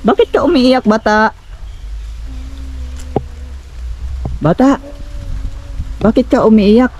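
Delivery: insistent questioning call. Real, not acted. Calls out to child.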